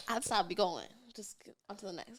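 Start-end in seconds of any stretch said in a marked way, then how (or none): 1.2-2.2 whispered aside. Real, not acted. Aside about moving on.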